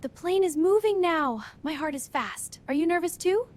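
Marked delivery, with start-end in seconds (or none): none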